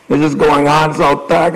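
ordinary talk, nothing marked

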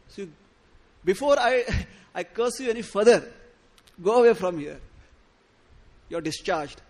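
loud, cutting guru's rebuke; angry dismissal. Acted. Guru cursing his disciple, shouting him away. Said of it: "cursed him and said".

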